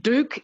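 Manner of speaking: "Duke" said as dook; yod-dropped Duke. Shown as a demonstration. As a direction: drop the y-glide from "Duke". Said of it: "three ways of pronouncing".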